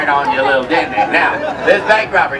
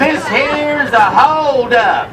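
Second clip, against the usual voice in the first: higher, slow hammed-up outlaw growl; mean outlaw voice; 8 semitones higher, slower than usual. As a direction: sneer it out slowly like a cartoon varmint-hater. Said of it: "in a loud, mean voice".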